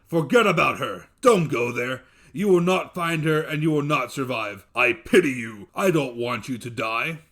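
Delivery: loud, urgent monster voice; a giant begging him to stay; pleading dev. Acted. Dev urging his brother-in-law not to go, loud and urgent.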